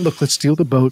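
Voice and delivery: ordinary delivery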